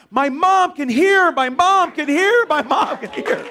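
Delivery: high, loud, slow exultant chant; jubilant little girl. Retold or imitated. Little girl dancing because her mother can hear, jubilant. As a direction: cry it out, breaking into laughter. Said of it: "the little girl starts dancing".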